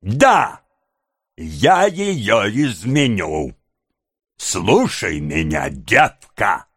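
drawn-out, heated threats; out of patience. A narrator's reading. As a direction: threaten deliberately, pitch climbing with fury. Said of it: "окончательно выведенный из терпения".

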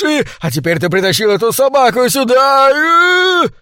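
high-pitched hysterical shriek, stretched like a boiling kettle's whistle; whistling shriek. A narrator's reading. Maître d' rants about the dog, shrieking like a kettle. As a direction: screech it out, vowels rising into a squeal. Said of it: "а в горле засел свисток".

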